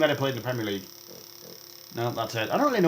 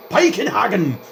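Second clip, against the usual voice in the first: loud, high mock-German shout of Piekenhagen; yelled with funny accent; 6 semitones higher, 5 dB louder, slower than usual. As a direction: yell Piekenhagen like a silly German announcer. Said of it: "I'm just gonna do that every time".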